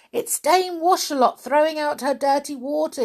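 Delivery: yelled warning. Acted. Joe warning his friends about falling water, yelled fast. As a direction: yell it out in a rush to warn them. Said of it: "he yelled".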